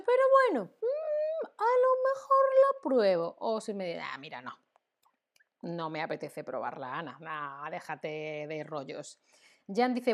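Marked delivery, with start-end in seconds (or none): 0.0-3.3 hesitant high voice. Acted. Voicing a hesitant learner. 4.1-9.2 dismissive. Acted. Voicing a refusing friend.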